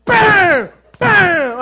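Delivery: loud, high mock impact bam; imitated crash bam. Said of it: "it was like".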